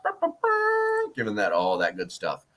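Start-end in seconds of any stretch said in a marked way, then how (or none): 0.0-1.1 mock burr noise. Mock sound while recounting praise.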